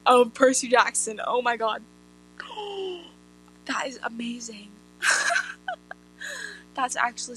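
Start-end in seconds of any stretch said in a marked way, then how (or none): 2.4-3.0 sharp little gasp; surprised gasp. Real, not acted. Reacting to own joke. 3.7-4.7 breathy awed whisper. Real, not acted. Awed reaction after gasp.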